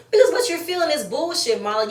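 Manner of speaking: fed-up rant at Marlo; heated outburst. Real, not acted. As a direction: snap it back at her. Ranting at Marlo on recap.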